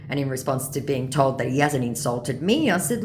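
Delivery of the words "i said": usual speaking voice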